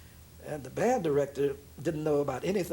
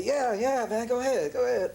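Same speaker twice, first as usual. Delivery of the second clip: cheering him on; 8 semitones higher than usual. Retold or imitated. Bandleader cheering the solo.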